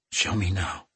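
soft, rough croak. A narrator's reading. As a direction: croak it out, barely voiced. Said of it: "she croaked".

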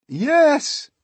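high, loud cry of agreement; loud high shout. A narrator's reading. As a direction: call it out eagerly as a whole crowd. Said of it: "they cried".

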